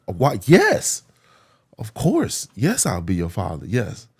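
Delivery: high, loud, delighted acceptance; overjoyed yes. Retold or imitated. Teacher agreeing to be his father, loud delighted yes. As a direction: burst out with joy, then settle.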